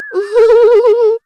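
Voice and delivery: a loud, high burst of laughter. Acted. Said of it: "बहू जोर जोर से हंसने लगती". (ज़ोर से ऊँची हँसी)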